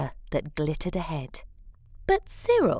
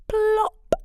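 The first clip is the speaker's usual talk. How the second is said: playful high plop; 13 semitones higher, 4 dB louder than usual. A narrator's reading. Narrator sound effect on splash.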